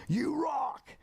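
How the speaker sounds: very high, held-out fan yell; mock yell. Starstruck fan to [name], high mock yell. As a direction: call it out high without real volume. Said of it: "he yelled back".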